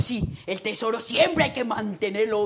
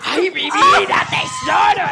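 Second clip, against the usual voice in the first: shouted catchphrase; 7 semitones higher, 9 dB louder than usual. Acted. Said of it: "creo que Adela se la perdió".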